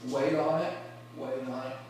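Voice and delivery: low, soft, held back; hushed patience. Acted. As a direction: hold it in quietly, waiting for the moment. Acting the patient, anticipating player.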